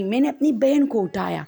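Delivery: ordinary talk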